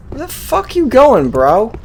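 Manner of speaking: ordinary talk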